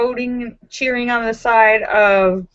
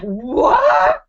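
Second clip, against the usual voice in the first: stunned cry. Retold or imitated. Player reacting to the fumble call, high stunned cry. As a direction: imitate the shocked player's outcry. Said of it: "He was like".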